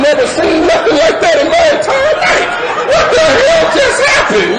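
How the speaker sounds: very high, hammed-up panic shout; shocked outburst. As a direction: cry out in comic disbelief. Retold shock at her fit.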